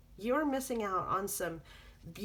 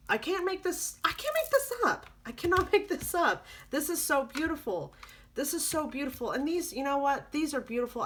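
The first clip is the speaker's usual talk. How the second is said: higher and louder, laughing with delight; excited; 7 semitones higher, 4 dB louder than usual. Real, not acted. Reader thrilled by the cards for the listener, excited. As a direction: gush with amazed excitement.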